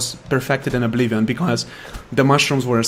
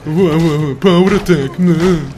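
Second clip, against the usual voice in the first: ordinary talk, nothing marked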